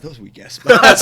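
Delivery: loud, laughing put-down of the spots; mocking laugh. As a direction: scoff loudly through a laugh. Mocking the guy's party spots.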